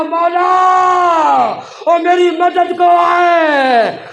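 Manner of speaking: long, slow, loud and high cry; desperate call for help. A narrator's reading. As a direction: cry out for help with the last breath. Wounded man calls for his brother. (धीमी, ऊँची, बेबस पुकार)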